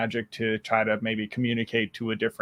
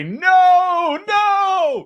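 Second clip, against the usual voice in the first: very high, louder ghostly "No, no"; eerie wailing voices; 8 dB louder than usual. Pretend. Voicing the madhouse signs from Caligari in a very high ghostly wail. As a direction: wail it like a haunted chorus.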